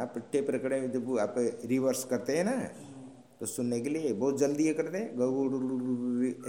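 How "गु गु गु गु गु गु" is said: rapid gu-gu chatter like a rewinding tape; imitating reversed tape. Teacher imitating a tape recorder played in reverse, rapid gu-gu. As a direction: mimic garbled sped-up playback. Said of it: "ऐसे बोल जाता है".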